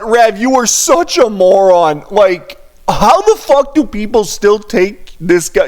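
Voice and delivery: ordinary talk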